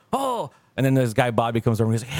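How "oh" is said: mock amazed oh. Friend amazed at reset tablet, high mock-amazed oh. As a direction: play the friend impressed by the reset.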